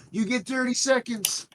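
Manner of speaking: very high-pitched yell; in-character call. Acted. Character calling back to Sir Thomas, high-pitched yell. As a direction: yell it high, as if over your shoulder. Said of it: "look back over my shoulder at Sir Thomas and go".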